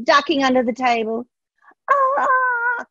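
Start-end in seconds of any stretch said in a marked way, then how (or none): 1.9-2.9 much higher startled cry; frightened cry. A narrator's reading. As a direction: cry out like a fleeing king. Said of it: "cried the King".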